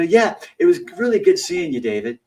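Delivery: high, loud sweet mock farewell; sugary fake goodbye. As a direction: sweetly use the wrong name on purpose. Deliberately wrong name again.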